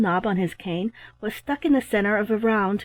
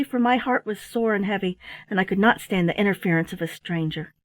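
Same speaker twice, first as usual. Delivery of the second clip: ordinary delivery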